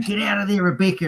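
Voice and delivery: high-pitched retold spectator call; imitated trackside urging. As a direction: call out urgently, as retold in fun.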